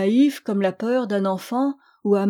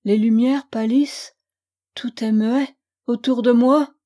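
usual speaking voice

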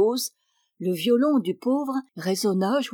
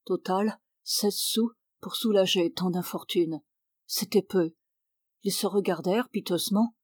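plain reading voice

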